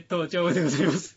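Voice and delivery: loud laughing quote. Retold or imitated. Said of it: "っていう".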